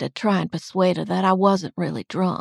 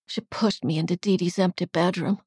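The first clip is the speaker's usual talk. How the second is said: plain reading voice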